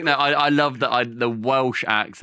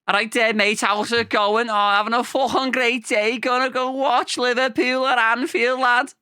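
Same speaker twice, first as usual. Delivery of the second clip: high-pitched, chirpy Liverpool lad; cheery Liverpool football fan; Scouse accent; 11 semitones higher than usual. Acted. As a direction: put on broad, high Scouse. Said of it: "we put the Scouse accent".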